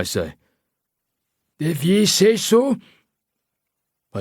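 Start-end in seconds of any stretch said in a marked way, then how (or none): 1.6-2.8 slow, high Scottish taunt; smug Scottish accent. A narrator's reading. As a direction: shrug it off like a cocky Scot. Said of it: "he says in a Scottish accent".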